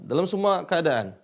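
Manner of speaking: usual speaking voice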